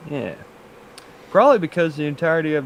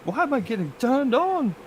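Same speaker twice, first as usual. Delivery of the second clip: high falsetto; 9 semitones higher than usual. Acted. Playing Homer.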